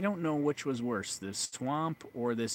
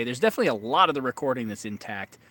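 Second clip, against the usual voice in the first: ordinary talk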